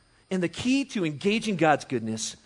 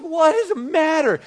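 high, loud imitation of a concerned mom; 11 semitones higher, 8 dB louder than usual. Preschool principal to crying little boy, acted concern. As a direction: play up a mother's alarmed question.

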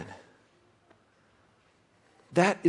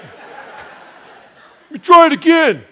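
laughing; 14 dB louder, much slower than usual. Pretend. Pretend diver laughing, tries again.

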